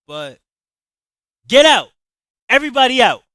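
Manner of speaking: very loud, high furious yell. Acted. Javon throwing his friends out of the party, furious yell. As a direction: drive everyone out of the house. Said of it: "I yelled out".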